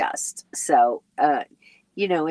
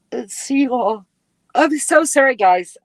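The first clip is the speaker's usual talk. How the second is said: yawning mid-apology, higher; talking through a yawn; 7 semitones higher, 3 dB louder than usual. Real, not acted. Sleepy host apologizing to her live viewers, yawning. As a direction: stretch the words out on a yawn. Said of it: "that's making me yawn".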